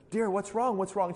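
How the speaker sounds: a touch of anxious sympathy; concerned deacon. Retold or imitated. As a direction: ask as a worried helper, lightly acted. Deacon gently asks crying woman.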